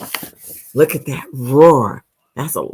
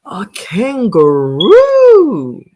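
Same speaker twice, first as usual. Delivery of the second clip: excited reveal; 6 semitones higher, 4 dB louder, much slower than usual. Acted. Dramatic reveal to kids.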